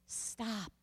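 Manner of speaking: hushed, gentle stop; calm them down with a soft stop. Retold or imitated. God reminding a frightened listener, hushed and gentle. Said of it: "God's right there to remind you".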